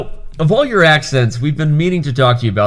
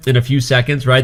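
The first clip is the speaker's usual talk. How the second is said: usual speaking voice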